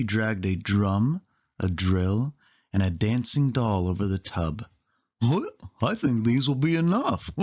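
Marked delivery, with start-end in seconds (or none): 5.2-7.3 higher goofy cartoon voice; dopey cheerful cartoon dog. A narrator's reading. Goofy sure the pile is enough, goofy higher voice. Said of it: "said Goofy".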